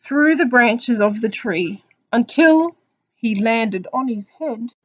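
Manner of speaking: loud, drawn-out picture-book reading; slow down and build suspense for children; story narration. Acted. Teacher reading of the apple's discovery, slow story narration.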